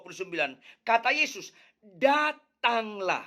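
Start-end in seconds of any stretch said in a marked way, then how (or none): 1.8-3.2 Datanglah drawn out slowly with weight; the key word Datanglah, said slow; stressed Datanglah. Shown as a demonstration. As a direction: lean hard on Datanglah, stretching it. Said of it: "itu penekanan".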